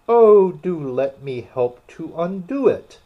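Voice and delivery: eager. A narrator's reading. Alice eager to help.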